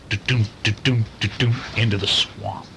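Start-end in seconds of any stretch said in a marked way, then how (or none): none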